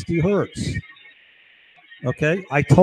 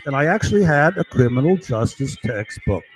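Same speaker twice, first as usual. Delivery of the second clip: ordinary delivery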